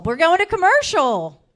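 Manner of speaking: shouted announcement of the break; full shout. Real, not acted. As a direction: belt it out so the whole room hears. Host calling the break again.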